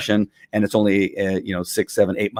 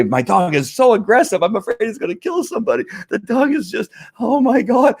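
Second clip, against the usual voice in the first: much higher, frantic worried owner; panicked owner; 12 semitones higher than usual. Retold or imitated. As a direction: play the terrified dog owner, voice climbing. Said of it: "Some people come to me and say".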